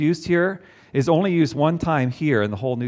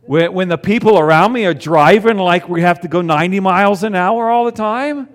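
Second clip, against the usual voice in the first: exasperated; 5 semitones higher, 8 dB louder than usual. Acted. Exasperated rant about bad drivers.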